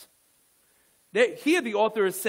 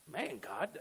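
quiet complaint; 12 dB quieter than usual. Retold or imitated. Retold private complaint to God.

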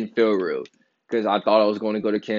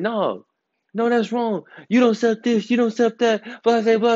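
mocking critics; 13 semitones higher than usual. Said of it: "somebody saying".

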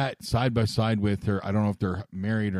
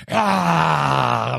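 loud, higher mock scream; imitated wild-man yell; 5 semitones higher, 6 dB louder than usual. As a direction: let out a wild wrestler's "aah!". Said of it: "And then there's Juice".

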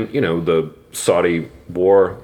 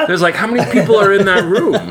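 high laughing question; 13 semitones higher, 5 dB louder than usual. Real, not acted. Laughing while telling.

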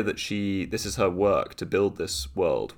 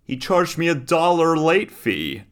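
high, loud, slow smug dad voice; mock proud dad; 10 semitones higher, 8 dB louder than usual. Reading the dad's post aloud, as a mock smug father. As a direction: ham up the boastful father.

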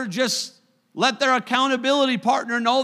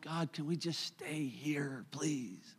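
slow, hushed whisper to God; whispered plea; 8 semitones lower, 15 dB quieter, slower than usual. Retold or imitated. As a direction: breathe it like a private prayer. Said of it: "I'm just like".

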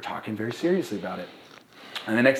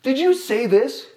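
pointed question; 12 semitones higher, 6 dB louder, slower than usual. Retold or imitated. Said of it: "And I was like".

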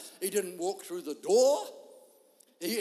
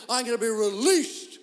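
preached climax; 2 semitones higher, 8 dB louder than usual. Acted. Climax of the repetition.

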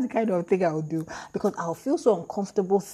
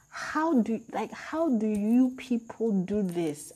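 high, slow, incredulous; drawn-out baffled question; exasperated disbelief; 4 semitones higher, slower than usual. Retold or imitated. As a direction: ask it as if nobody could possibly do this. Disbelief at characters' choices.

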